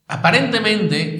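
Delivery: Aparentemente said high and loud with a falling end; stress on aparentemente. Real, not acted. Preacher stresses a word to the congregation: Aparentemente, high and loud. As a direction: lean hard on Aparentemente. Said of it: "recalco la palabra aparentemente".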